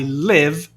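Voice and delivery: LIVE with a lax vowel, near "lev"; short I in live. Shown as a demonstration. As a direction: say live to rhyme with give. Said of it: "no sentido de morar".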